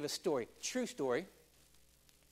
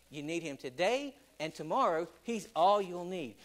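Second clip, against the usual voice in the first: normal talking voice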